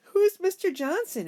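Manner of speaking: put-on high, loud boy's question; a bewildered kid asking; Toby blurts out a baffled question; puzzled boy. A narrator's reading.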